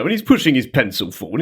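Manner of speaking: normal talking voice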